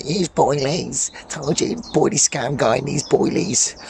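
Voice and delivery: high, slow, stretched-out mocking voice; mocking critics. Angler quoting people who moan about boilies, mocking voice. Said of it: "it's where people are like".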